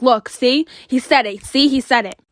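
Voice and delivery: loud, pushy "see, he said it"; imitating a taunting girl; retold insistent shout. Retold fight, girl shoves messages.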